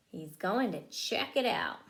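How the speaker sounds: ordinary delivery, nothing marked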